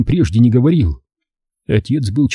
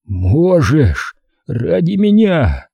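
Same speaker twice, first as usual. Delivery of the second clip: drawn-out plea, rising higher; pleading dying father; 5 semitones higher, much slower than usual. A narrator's reading. Father urges his faltering son on, strained and pleading. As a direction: coax him with the last strength.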